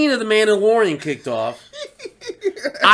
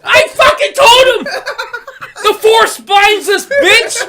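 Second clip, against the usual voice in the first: high, loud vindicated wailing; crying, shouted gloat; hammed-up tearful yell; 10 dB louder than usual. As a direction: yell it through mock tears of triumph. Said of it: "I'm literally bawling".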